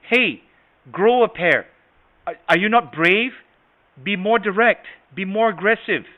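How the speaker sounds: loud, high, pushy challenge; loud talker. Acted. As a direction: bark it as an aggressive loud talker. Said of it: "somebody that talks loud".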